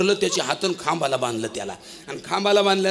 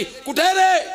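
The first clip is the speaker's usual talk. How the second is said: high angry shout at the wife; hungry man storms in yelling; 12 semitones higher, slower than usual. Acted. Hungry husband yells for his wife, angry and high. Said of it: "रागानं आला घरी".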